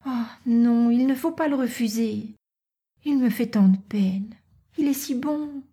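high, gentle voice of a daughter; pitying young Natacha. Acted.

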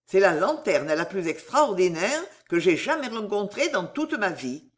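usual speaking voice

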